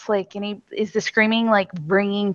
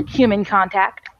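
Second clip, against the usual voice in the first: ordinary talk, nothing marked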